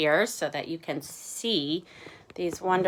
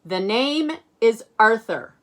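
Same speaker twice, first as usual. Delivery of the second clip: Arthur correcting; 5 semitones higher, 9 dB louder than usual. Acted. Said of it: "He says".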